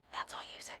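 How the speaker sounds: whispered repeat; a soft whispered echo of the advice. Shown as a demonstration. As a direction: breathe it out in a whisper. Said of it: "repeated as a whisper".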